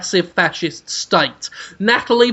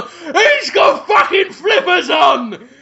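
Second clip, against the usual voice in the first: hammed-up high shout, slow; mock shocked shout; 9 semitones higher, slower than usual. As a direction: yell it in disbelief.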